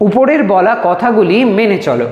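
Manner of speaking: usual speaking voice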